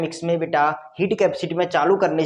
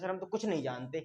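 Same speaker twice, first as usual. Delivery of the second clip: softer, lower mock student whining; 4 semitones lower, 11 dB quieter than usual. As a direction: play the clueless student, hushed. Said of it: "quoted student reply".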